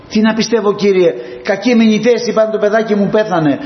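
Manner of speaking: put-on a father who has just lost his child; desperate father. Desperate father Jairus answers.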